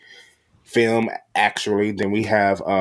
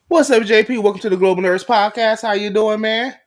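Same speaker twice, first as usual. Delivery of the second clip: high, loud show-opening shout-out; hype greeting; 12 semitones higher, 4 dB louder than usual. Real, not acted. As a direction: kick off the show with big energy. Host welcomes guest enthusiastically.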